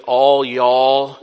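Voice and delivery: all y'all drawn out in a slow Southern drawl. Shown as a demonstration. Preacher jokes to his flock in a slow Southern drawl. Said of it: "using the southern drawl".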